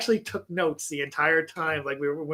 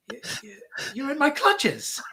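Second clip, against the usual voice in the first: mock villain; 9 semitones higher than usual. Retold villain voice.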